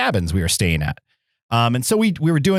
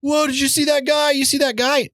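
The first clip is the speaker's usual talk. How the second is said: high-pitched mock disbelief; retold startled questions; astonished skiers. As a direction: imitate stunned onlookers checking with each other. Skiers reacting to gunman.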